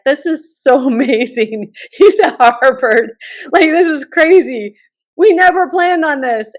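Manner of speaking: bubbling over with happiness; giddy joy. Retold or imitated. Mother thrilled at dropping son at Harvard, giddy laughing joy.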